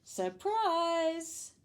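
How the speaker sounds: high, drawn-out party shout; shouted surprise. A narrator's reading. All the ladies surprise their guest, shouting. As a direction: shout it out, stretched. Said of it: "all the ladies shouted".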